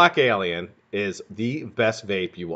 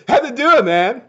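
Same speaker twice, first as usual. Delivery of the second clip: goofy greeting; 13 semitones higher, 6 dB louder than usual. Pretend. Playfully mangled greeting to a viewer.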